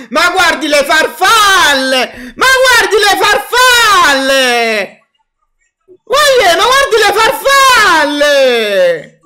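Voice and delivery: anguished outcry. Real, not acted. Fan cries out in frustration.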